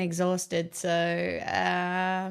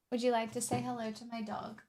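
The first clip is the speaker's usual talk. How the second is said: usual speaking voice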